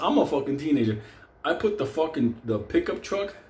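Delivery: ordinary delivery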